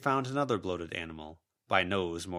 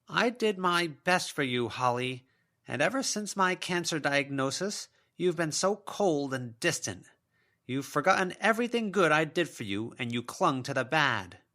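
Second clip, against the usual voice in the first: a mother's ghost listing her excuses; self-pitying spectral mother; wounded ghost mother; 9 semitones higher, 5 dB louder than usual. A narrator's reading.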